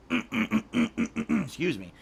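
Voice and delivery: slow, croaky through a clogged throat; clearing throat. Real, not acted. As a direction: force it out past a throat clear.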